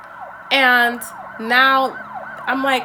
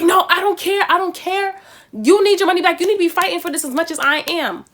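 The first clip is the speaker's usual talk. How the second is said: higher-pitched, insistent retold anger; really mad; 7 semitones higher, 3 dB louder than usual. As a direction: imitate a worked-up friend refusing to back down. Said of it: "he was really mad".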